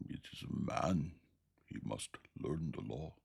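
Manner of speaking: altered beastly voice from the dark; thick whistling voice. A narrator's reading. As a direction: pronounce the law like a creature. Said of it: "thick voice, whistling overtone".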